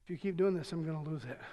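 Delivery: low, soft, ominous calm threat; quiet warning. Retold or imitated. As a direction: warn them softly, right before snapping. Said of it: "She'll say".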